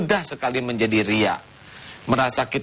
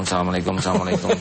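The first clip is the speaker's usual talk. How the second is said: assalamualaikum, assalamualaikum blurred together very fast; mock gabbled salam; 6 semitones lower, much faster than usual. Kiai mocks worshippers racing to finish prayer, very fast salam. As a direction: rattle the double salam off as if finishing in a rush. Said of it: "saking cepatnya begini".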